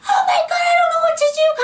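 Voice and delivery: very high, hammed-up frantic squeal; mock panic. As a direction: squeal it like a teen losing it. Imagined panic over crying doll.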